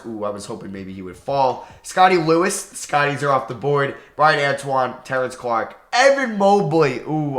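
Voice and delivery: ordinary talk, nothing marked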